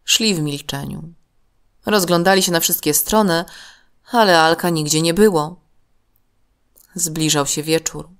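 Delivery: plain reading voice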